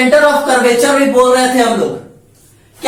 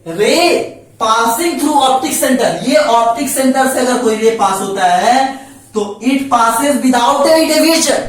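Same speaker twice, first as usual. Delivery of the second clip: slow dictation; slower than usual. Shown as a demonstration. Teacher dictating third rule.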